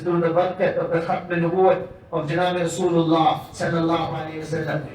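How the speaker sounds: ordinary talk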